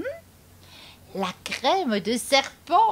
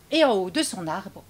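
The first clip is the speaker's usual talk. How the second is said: ordinary delivery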